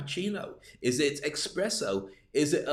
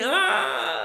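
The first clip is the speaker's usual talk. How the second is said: long, very high scream; mock terrified scream; 4 dB louder than usual. Tourist about to be bitten, long mock scream. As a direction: scream out in hammed-up terror. Acted scream at biting dog.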